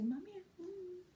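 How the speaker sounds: put-on high, soft, whimpering little child; whiny toddler. Toddler asking for her nappy change, high and whiny. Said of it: "She'll say".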